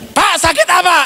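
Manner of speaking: high-pitched curious question; asking the patient. Retold or imitated. Asks sick man in hospital. (bertanya pada pasien dengan nada tinggi)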